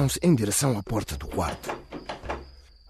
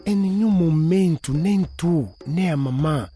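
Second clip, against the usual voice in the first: sobbing; 5 semitones higher, 3 dB louder than usual. A narrator's reading. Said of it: "ela começou a soluçar".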